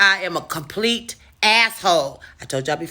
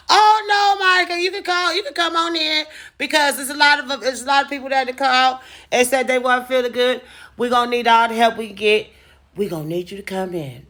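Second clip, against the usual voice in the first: much higher, put-on sweet boss voice; boss on the phone; 4 semitones higher than usual. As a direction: play the chirpy boss pleading down the line. Boss on phone asking her in.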